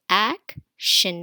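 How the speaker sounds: "action" broken into ac and shin; split into syllables. Shown as a demonstration. Teacher showing the two syllables, split apart. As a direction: pause between both halves of "action". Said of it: "this word has two syllable".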